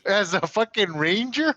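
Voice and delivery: high, loud, slow outraged question; can't believe this happened; outraged disbelief. Real, not acted. Player outraged at blinding.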